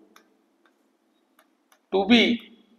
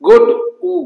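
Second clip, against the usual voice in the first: much higher and louder on good; emphatic example; 12 semitones higher, 8 dB louder than usual. Shown as a demonstration. Teacher pointing students to the example, loud and high. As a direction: pop the example word out. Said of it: "ଦେଖ ପିଲା".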